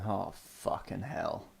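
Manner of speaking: low-key, drawn-out groan of dread; soft, slow exasperated mutter; muted dismay. Retold or imitated. Viewer reacting to the film's opening, muted dismay.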